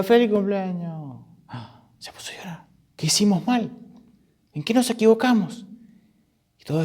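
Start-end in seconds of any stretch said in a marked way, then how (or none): 0.0-1.2 high, stretched-out cheer fading away; acted party cheer. Party guests greet a girl who starts crying, high fading cheer. 1.5-2.6 barely voiced stage whisper; hushed dismay. Guests notice the birthday girl crying, hushed dismay. 3.0-5.7 high-pitched, anxious questioning; worried guests. Acted. Guests wonder why the birthday girl cries, acted worry.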